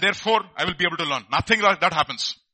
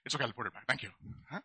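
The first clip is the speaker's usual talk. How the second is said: ordinary talk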